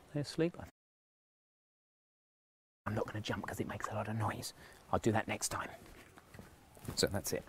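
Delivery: hushed, trying not to wake anyone; quiet at night. Real, not acted. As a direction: keep it down, people sleeping nearby. Neighbours asleep, late at night.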